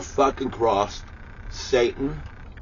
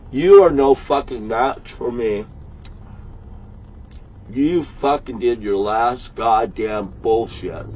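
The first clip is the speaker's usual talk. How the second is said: voicing God. God warning his enemies, slow booming wrath. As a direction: thunder it out like an angry god. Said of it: "God says".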